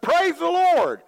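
excited praise. Retold or imitated. Pastor to the young convert who cussed, high, loud joyful exclaim. Preacher surprises convert about cussing.

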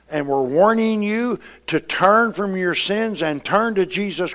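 loud, high-pitched preaching build; preaching at full force. Acted. Pastor to church, preaching at full force. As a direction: drive it home like a revival preacher.

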